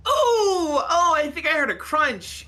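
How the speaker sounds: long high wincing "oh"; excited reaction. Acted. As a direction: react like watching a brutal replay. Reacting to replayed punches in play.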